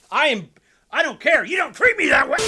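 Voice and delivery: high, loud, hammed-up indignant shout; mock outrage. Pretending a friend has insulted him, a hammed-up indignant shout. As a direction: yell it in pretend offence.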